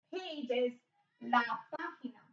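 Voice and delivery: ordinary delivery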